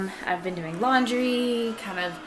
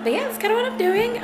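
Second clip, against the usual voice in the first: high squeaky voice; 14 semitones higher, 5 dB louder, faster than usual. Pretend. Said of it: "I don't know why I sounded like that".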